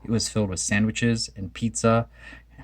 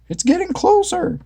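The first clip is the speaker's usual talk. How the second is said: very high panicked cry; frightened cry. A narrator's reading. Shaggy crying out as the serpent nears. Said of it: "Shaggy cried".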